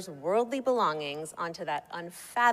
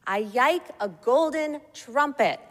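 put-on higher, louder small-child voice; toddler announcing; 6 semitones higher, 5 dB louder than usual. A narrator's reading. Child telling Santa his wish, announced in a toddler voice. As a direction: announce it proudly in toddler talk. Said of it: "he announced".

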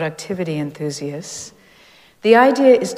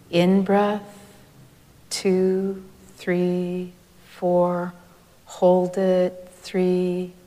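guided breath count; much slower than usual. Shown as a demonstration.

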